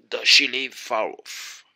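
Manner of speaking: sly wolf question. A narrator's reading. Wolf voiced in read story.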